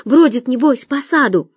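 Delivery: ordinary delivery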